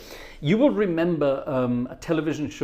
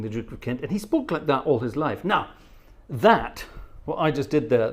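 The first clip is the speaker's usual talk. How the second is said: ordinary talk, nothing marked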